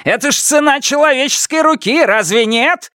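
very high, strained outrage; high-pitched furious outburst; roaring. A narrator's reading. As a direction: cry out in bitter fury. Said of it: "взревел он".

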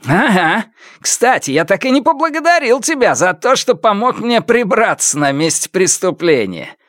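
high, smug villain voice; mocking gloat. A narrator's reading. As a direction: thank him mockingly, pitched well up. Villain mockingly thanks the hero. (издевательская благодарность высоким голосом)

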